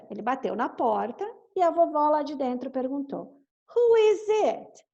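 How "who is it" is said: put-on high, slow old granny asking. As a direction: call out like an old granny behind the door. Granny asks through the door.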